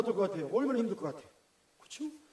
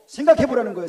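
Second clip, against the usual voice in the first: emphatic louder; 8 dB louder, faster than usual. Acted. Repeated for emphasis.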